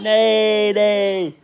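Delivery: drawn-out playful neighing; whinny like a horse. Pretend. Dad copying a horse for his child, playful neigh. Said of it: "What about a horse?".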